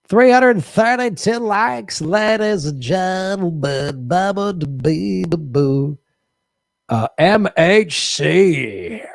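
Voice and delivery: slow, grand host voice calling the crowd; hammed-up announcer. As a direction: stretch it out like a game show host. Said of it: "host announcer bit".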